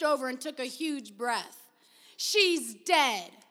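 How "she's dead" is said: loud blunt announcement. Acted. Robbie announces the doll's death.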